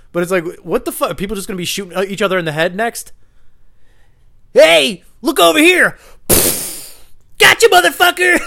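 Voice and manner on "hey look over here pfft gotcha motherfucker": shrieking high prankster voice, much higher and louder; a shrill cackling prank-show host shouting gotcha; mock prankster. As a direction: yell it like a gleeful idiot prankster. Mocking prank YouTubers.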